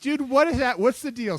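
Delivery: high, loud exasperated cry of disbelief; exasperated outburst. Real, not acted. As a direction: burst out in baffled frustration. Venting gym frustration to cohost.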